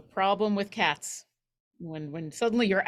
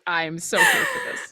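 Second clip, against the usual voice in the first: usual speaking voice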